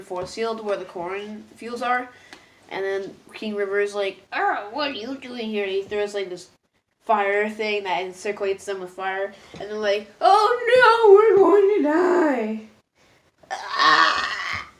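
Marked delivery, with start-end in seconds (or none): 4.3-5.6 higher-pitched, put-on startled villain; mock villain outburst. King River confronting the group, mock outburst. Acted villain catches the intruders. 10.2-14.7 loud, high, drawn-out wail of doom; hammed-up terrified shriek, stretched out at the end; mock panic scream. Group trapped in a ring of fire, mock panic.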